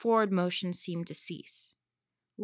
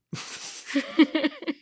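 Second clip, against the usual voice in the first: villain chuckle; 7 semitones higher than usual. Acted. Loki's voiced laugh.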